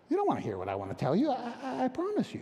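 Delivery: fast, half-laughing aside to the congregation; quick teasing aside, ending high; rushed aside. Real, not acted. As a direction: rattle it off, then squeak out the promise.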